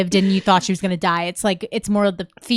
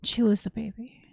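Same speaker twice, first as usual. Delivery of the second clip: whispered, worried question; hushed fearful question; 11 dB quieter than usual. Real, not acted. Friend gasps at the story, whispering. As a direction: whisper it like you dread the answer.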